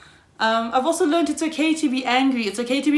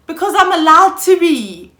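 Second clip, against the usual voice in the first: louder, higher, defiant retort; defiant outburst; 8 semitones higher, 11 dB louder than usual. Real, not acted. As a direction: fire it back, higher and louder. Defiant answer to quoted question.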